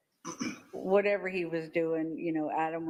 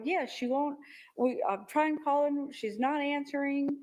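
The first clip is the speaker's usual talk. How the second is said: higher-pitched retelling of a frantic caller; retold freaking out; 8 semitones higher than usual. Witness retelling her nephew's call about reaching Lori, mildly frantic. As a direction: imitate a worried caller, voice pitched up. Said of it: "He was freaking out".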